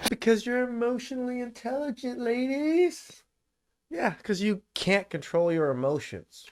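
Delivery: high mocking sing-song jab; mock her with fake praise; sarcastic. Real, not acted. Mocking a crying fired intern, sarcastic and high.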